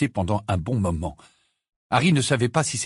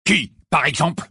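sharp, irritated retort; 6 semitones higher, 5 dB louder than usual. A narrator's reading. Sirius snaps back at Ginny, irritated. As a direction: cut her off. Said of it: "d'un ton agacé".

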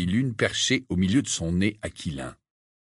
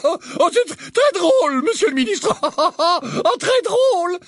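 much higher, sycophantic laughing; 5 dB louder, slower than usual. Acted. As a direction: fawn over the boss's joke, laughing. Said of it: "Percy Weasley éclata de rire".

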